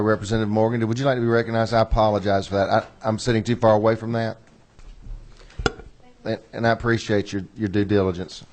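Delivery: ordinary talk, nothing marked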